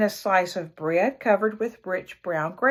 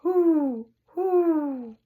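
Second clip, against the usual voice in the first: higher asking hoot; owl hoot; 7 semitones higher than usual. Acted. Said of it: "the owl asking".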